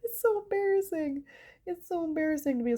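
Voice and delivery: high whiny complaint. Real, not acted. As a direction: whine it up high.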